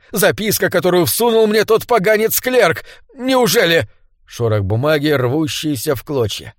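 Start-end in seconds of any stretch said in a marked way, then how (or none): 0.1-3.9 high, agitated indignation; seething through teeth. A narrator's reading. Sir Andrew recalls the clerk's note, seething. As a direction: spit out the accusation, then doubt it. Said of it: "процедил он".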